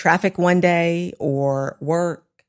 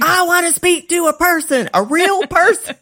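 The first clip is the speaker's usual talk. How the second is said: much higher, louder mock yell; retold yell at phone; 12 semitones higher, 5 dB louder than usual. Caller yelling at automated phone line, exasperated shout. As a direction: shout it like you're fed up with a robot line. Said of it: "I start yelling at the phone and I'm like".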